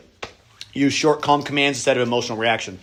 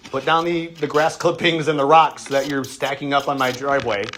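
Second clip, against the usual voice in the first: usual speaking voice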